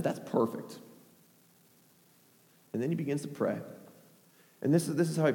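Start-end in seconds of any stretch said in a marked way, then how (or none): 0.0-0.8 near-whispered approval; low, hushed deadpan; quiet satisfaction. Acted. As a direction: say it softly, as if to yourself. Elijah coolly satisfied with soaked altar.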